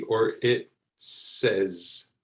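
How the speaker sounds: "says" stretched slowly with a short e; drawn-out "says" for learners; slow model word. Shown as a demonstration. Teacher modeling "says" for learners, slowly. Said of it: "this word is pronounced like the beginning".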